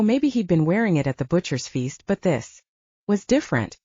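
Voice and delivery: ordinary delivery, nothing marked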